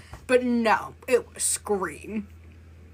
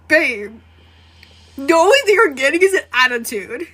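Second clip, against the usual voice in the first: sassy reply; 6 semitones higher, 9 dB louder than usual. Pretend.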